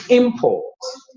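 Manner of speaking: Imports pitched high on IM, then dropping; first syllable high. Shown as a demonstration. Teacher repeating the noun for learners, high stressed IM in Imports. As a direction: make the IM of Imports high and strong. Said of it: "first syllable high".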